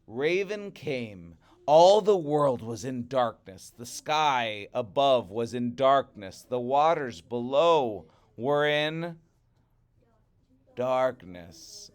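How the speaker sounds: loud, grand storyteller voice; dramatic story narration. A narrator's reading. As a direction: boom out the opening like a legend.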